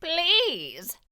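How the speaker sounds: high, pleading wail; a suffering fox pleading. A narrator's reading. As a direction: beg pitifully. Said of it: "the fox wailed".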